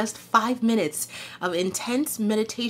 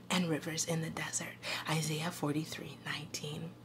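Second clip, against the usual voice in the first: hushed voiceless whisper; 5 semitones lower, 14 dB quieter than usual. Real, not acted. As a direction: breathe the words out softly. Scripture reading trails into whisper.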